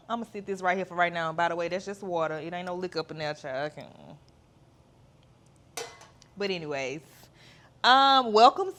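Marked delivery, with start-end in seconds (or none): none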